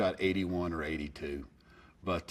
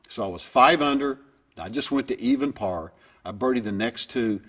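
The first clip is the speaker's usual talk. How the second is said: ordinary talk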